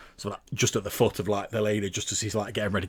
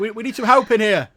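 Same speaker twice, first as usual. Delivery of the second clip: high, loud panicked shouting; desperate cries for help; 13 semitones higher, 14 dB louder than usual. Acted. GM voices prisoners calling out.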